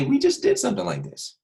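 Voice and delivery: put-on squeaky high child voice; imitating a student. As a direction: mimic a whiny pupil. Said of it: "You might say".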